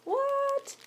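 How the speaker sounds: high mock-surprised what; astonished. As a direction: act surprised for the kids. Dandelions everywhere reveal.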